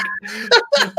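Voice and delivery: high burst of laughter; laughing. Real, not acted. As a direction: crack up. Laughing at clip.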